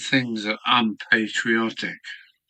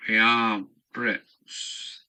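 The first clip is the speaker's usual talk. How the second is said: drawn out; much slower than usual. Pretend. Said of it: "slowed chant".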